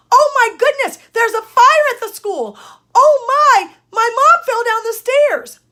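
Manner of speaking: loud, high-pitched mock alarm; emergency exclamation. Shown as a demonstration. Teacher acting out an emergency, loud exclaimed alarm. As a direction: cry it out as if raising the alarm. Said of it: "and you say it like this".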